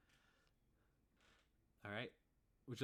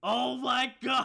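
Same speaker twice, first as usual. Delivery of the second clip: high, loud "oh my god" breaking into laughter; real shocked laugh, drawn out and much louder; laughing outburst; 14 semitones higher, 17 dB louder, slower than usual. Real, not acted. Game master reacts to a player's roll, loud laughing outburst.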